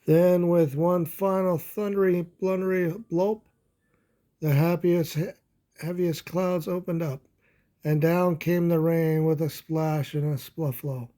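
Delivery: lively storybook read-aloud; animated children's story narration. Acted. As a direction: read it like a bedtime picture book. Reading picture book aloud.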